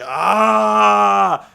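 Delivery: loud mock monster roar; hammed-up wrestler roar; Abyss yell. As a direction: bellow it with arms splayed wide. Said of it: "he's like".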